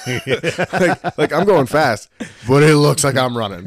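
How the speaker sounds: loud, high, laughing through it; laughing loudly. Real, not acted. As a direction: crack up while you explain.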